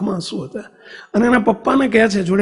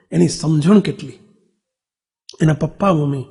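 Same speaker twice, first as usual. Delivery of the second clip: usual speaking voice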